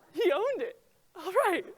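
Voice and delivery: laughing it out, high and loud. Real, not acted. Telling the audience about the support punch, laughing hard. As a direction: crack up while telling it.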